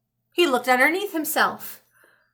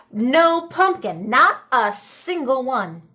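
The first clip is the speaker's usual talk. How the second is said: usual speaking voice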